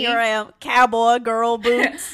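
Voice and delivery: hammed-up cowgirl, close to laughing; mock cowgirl. Laughing through cowboy bit.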